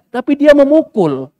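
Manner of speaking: high and loud, slow and deliberate; outraged emphasis. Real, not acted. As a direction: hit it like an accusation. Preacher raising outrage.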